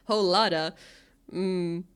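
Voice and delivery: normal talking voice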